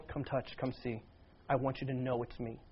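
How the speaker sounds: soft, low, gentle invitation; tender reassurance. Retold or imitated. Jesus inviting Thomas to touch and see, tenderly reassuring.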